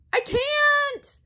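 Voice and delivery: high-pitched scared scream. A narrator's reading. Peach rolling downhill screams back to Blue, high and scared. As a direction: shriek it while tumbling away. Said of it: "screamed Peach".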